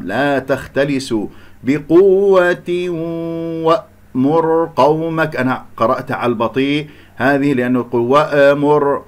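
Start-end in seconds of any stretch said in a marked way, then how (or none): 1.6-5.4 بقوة وأمر قومك slowly chanted, long hum into the و; kasra then merging. Shown as a demonstration. Teacher modelling idgham, slow held recitation of بقوة وأمر. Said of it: "أكسر ثم أدخل في الإدغام". 8.0-9.0 وأمر with a wrong echoing release after the ء; bounced hamza. Shown as a demonstration. Teacher quoting a mistake, qalqala on the ء of وأمر. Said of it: "قلقلة، وأمر هكذا ضعيفة".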